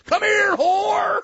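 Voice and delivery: high, loud, boisterous party yelling; hammed-up drunk showoff; rowdy bragging shout. [name] brags to the guys at the strip club, loud high shouting.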